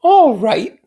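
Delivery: put-on high, airy young woman; lilting society girl; high voiced Daisy; Daisy's voice. A narrator's reading. Daisy voiced in read novel.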